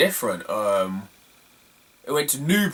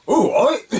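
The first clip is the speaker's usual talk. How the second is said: high, cheery mock greeting; a high, hammed-up hello from a friend on the platform; imitating a friend's greeting; 10 semitones higher, 4 dB louder than usual. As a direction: sing it out like a mate spotting you. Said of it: "I hear someone go".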